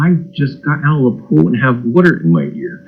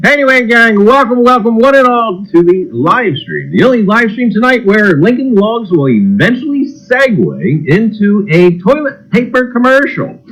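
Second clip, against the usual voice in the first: high, beaming announcer welcome; showman host intro; 8 semitones higher than usual. Acted. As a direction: greet the gang like a carnival barker. Host reopens the livestream.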